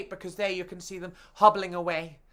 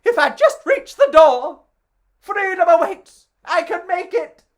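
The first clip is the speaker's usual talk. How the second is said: high, loud and breathless with hope; excited escaping sausage; 10 semitones higher, 10 dB louder than usual. Acted. Sausage racing for the door, high and excited. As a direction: reach for freedom, eager and high.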